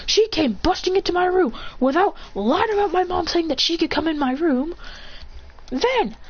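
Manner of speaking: high-pitched, indignant tirade; rage and anger. Retold or imitated. Boy lashing out at the clan that shamed him, enraged. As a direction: rant at them, pitch riding high throughout. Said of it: "in rage and anger, saying".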